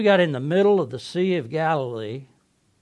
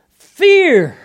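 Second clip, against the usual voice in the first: loud, high burst on one word; sudden cry; 12 semitones higher, 14 dB louder than usual. Acted. Fisherman recalling terror in the sinking boat, crying out. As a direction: punch it out as a dramatic shout. Storm story reaches its peak.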